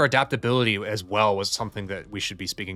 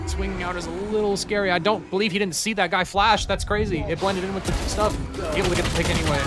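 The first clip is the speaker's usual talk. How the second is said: hyped, higher live call; esports caster; 8 semitones higher than usual. Acted. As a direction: call the round with rising hype.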